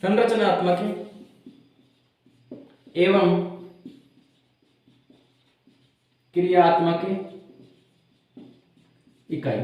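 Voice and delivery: word by word with long pauses; dictation. Real, not acted. Teacher giving students the definition to note down, paced dictation. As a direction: dictate it piece by piece, leaving time to write.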